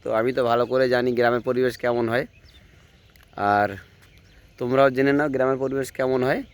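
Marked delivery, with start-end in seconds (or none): none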